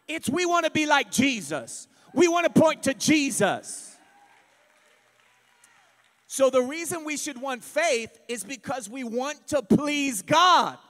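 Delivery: preaching at full force. Real, not acted. Preaching climax.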